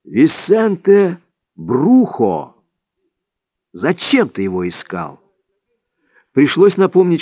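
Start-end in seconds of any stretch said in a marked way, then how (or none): 0.0-5.2 exclaiming. A narrator's reading. Don Juan questioning why his pupil sought Vicente, exclaiming. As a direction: proclaim the name, then drop into reproach. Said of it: "воскликнул Дон Хуан".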